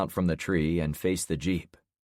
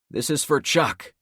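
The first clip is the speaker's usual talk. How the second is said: loud, higher defiant shout; yelling; 8 semitones higher, 5 dB louder than usual. A narrator's reading. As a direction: yell it at them. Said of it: "I yelled".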